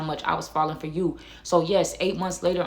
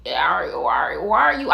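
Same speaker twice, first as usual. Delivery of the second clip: angry stammer; 4 dB louder, slower than usual. Retold or imitated. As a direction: trip over the first words, too mad to get them out. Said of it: "I'm stammering, I'm stuttering".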